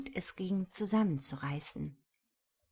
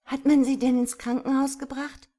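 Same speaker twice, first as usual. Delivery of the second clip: impatient, higher and louder question; girl blurts out an impatient, louder question; impatient interruption; 7 semitones higher, 10 dB louder than usual. A narrator's reading. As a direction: cut in, desperate to know. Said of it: "unterbrach ihn Sarah ungeduldig".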